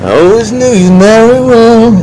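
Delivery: high, slow, put-on mocking voice; a hammed-up sweet voice, drawn out; mocking quote.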